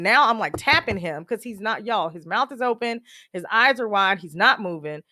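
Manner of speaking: ordinary talk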